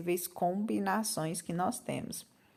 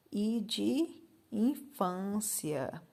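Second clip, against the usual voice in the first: slow spelled-out example; 4 dB quieter, much slower than usual. Shown as a demonstration. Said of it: "teacher spells letter slowly".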